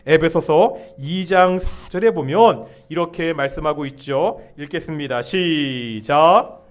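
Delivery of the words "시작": long drawn-out cue, rising at the end; calling the start. Real, not acted. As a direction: stretch it out to cue the congregation. 회중에게 읽기 시작 신호. (길게 늘여 읽기 시작을 알리는 구령)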